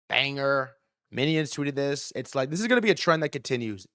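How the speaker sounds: ordinary talk, nothing marked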